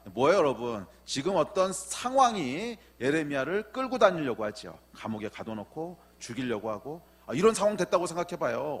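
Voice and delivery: ordinary talk, nothing marked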